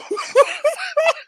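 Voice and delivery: high cackle. Real, not acted. Host laughing at her own joke, high cackle. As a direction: burst out in a high squeal of laughter.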